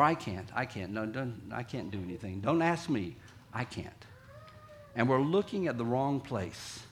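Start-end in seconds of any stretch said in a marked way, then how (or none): 0.0-4.0 low, soft, mumbled giving up; defeated refusal. Acted. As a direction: back away from the task, quietly. Voicing defeated weak people.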